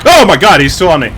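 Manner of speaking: high, loud panicked cry; yelling as the monster closes in; panicked shout. Real, not acted. Monster still chasing.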